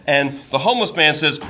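ordinary talk, nothing marked

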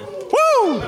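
very high wrestling woo whoop; Ric Flair woo. Shown as a demonstration. Storyteller finally gives the crowd the wrestler woo, high whoop. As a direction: let out a huge wrestler woo. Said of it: "You're supposed to do the woo when it's Ric Flair".

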